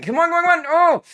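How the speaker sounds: high, loud hurried plea; desperate gamer pleading; frantic urging. Real, not acted. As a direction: blurt it fast and break into a cry. Last-second urging, then failing.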